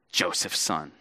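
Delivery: Joseph's son? dropped low and soft with a falling sneer; Joseph's son? low, quiet and falling with contempt; scornful put-down. Retold or imitated. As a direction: spit out "Joseph's son?" dismissively. Crowd turns contemptuous.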